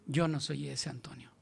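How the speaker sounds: whispered divine correction; whispering Spirit; whispered rebuke. Acted. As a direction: whisper it like a voice within. Holy Spirit rebukes the pastor.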